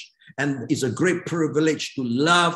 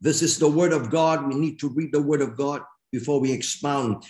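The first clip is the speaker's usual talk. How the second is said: normal talking voice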